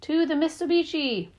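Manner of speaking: high, heroic rallying cry; booming superhero voice. A narrator's reading. Dad sending the family to the car, booming superhero voice. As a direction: proclaim it like a superhero. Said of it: "in his booming superhero voice".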